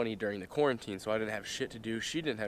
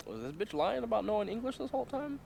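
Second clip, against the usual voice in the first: much higher, disbelieving rising voice; incredulous thought; 11 semitones higher than usual. Retold or imitated. Wondering if his date lied, high incredulous voice. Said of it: "that I was like".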